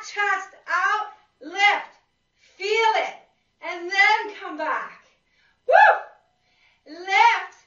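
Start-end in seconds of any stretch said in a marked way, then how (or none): 5.7-6.9 high, loud whoop of joy; excited whoop. Real, not acted. Trainer hyping up the class mid-rep, excited whoop. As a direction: cheer the class through the rep. Trainer cheering during exercise.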